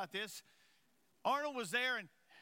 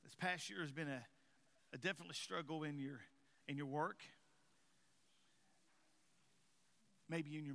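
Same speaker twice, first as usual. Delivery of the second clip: low, soft, measured with long pauses; subdued preaching; 7 semitones lower, 7 dB quieter than usual. Real, not acted. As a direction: let each phrase sit quietly. Preacher speaks softly to congregation.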